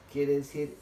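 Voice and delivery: ordinary delivery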